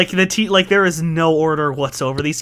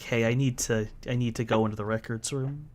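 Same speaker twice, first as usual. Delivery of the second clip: softer, low-pitched sheepish request; sheepish, tailing off quieter; 3 semitones lower, 8 dB quieter than usual. Acted. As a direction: ask hesitantly, keeping it small and sheepish.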